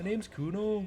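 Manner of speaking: quick Liverpool Scouse impression of Kuno; Kuno Scouse accent. Gamer showing how he thinks of Scouse, Kuno in Scouse accent. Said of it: "whenever I try and think of a Scouse accent".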